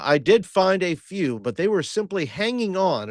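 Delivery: a touch of lofty halfling report; lightly voiced Hobbs. Player speaks as Hobbs.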